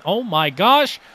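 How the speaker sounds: ordinary talk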